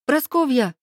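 high-pitched cry of a name; calling out. A narrator's reading. Heroine turns to the hare in the trees, calling out. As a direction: call out to someone hiding nearby. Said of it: "крикнула".

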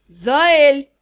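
loud, drawn-out roar of the name; angry bark. A narrator's reading. Father roaring at his rebellious daughter, angry bark. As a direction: bark it out angrily. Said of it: "рявкнул всё тот же мужской и сейчас очень злой".

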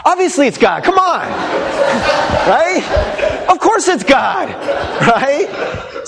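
high laughing teasing. Real, not acted. Teasing the congregation.

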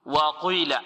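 وقيل with قي rounded to "qwee"; over-thicken the ق of وقيل with a w-glide; wrong pronunciation. Shown as a demonstration. Teacher mimics a common error, rounded faulty وقيل. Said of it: "هذا خطأ".

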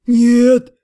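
a loud groaned no; groaning no. Acted. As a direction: groan the word out under strain. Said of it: "простонал Отто". (простонал громко)

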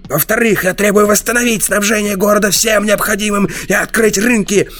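ranting tyrant, pitched high. A narrator's reading. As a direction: bark the demands from up high. Zenor shouting demands, raised pitch.

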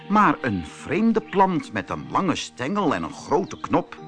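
ordinary delivery

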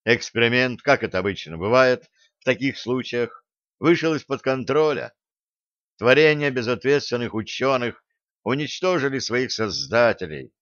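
ordinary delivery

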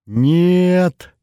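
громкий высокий протяжный крик отчаяния; выкрикнуть протест сквозь рыдания; рыдающий крик. A narrator's reading. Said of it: "прорыдала Олеся". (надрывно, с плачем)

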